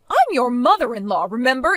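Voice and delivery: normal talking voice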